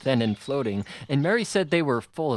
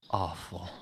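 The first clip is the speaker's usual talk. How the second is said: low, soft, trembling reply; 5 semitones lower, 8 dB quieter than usual. A narrator's reading. Officer answers mother's fear, low trembling voice. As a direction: let the word shake low and soft. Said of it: "in a trembling voice".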